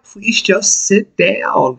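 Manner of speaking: slow, tired pleading; slow it down, begging him to sit; weary plea. Retold or imitated. Boss pleading with drunk roadie, slow and weary. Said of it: "And I'm like".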